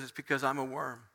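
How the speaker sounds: low, soft, resigned lament; subdued, defeated admission; dejected self-blame. Acted. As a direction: sink quietly into blaming yourself. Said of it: "He says".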